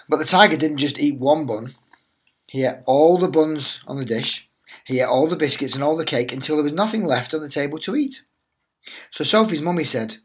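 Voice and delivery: lively storybook read-aloud voice; animated teacher reading The Tiger That Came to Tea; reading to children. Acted. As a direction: tell it like a bedtime picture book for little ones. Teacher reads picture book aloud.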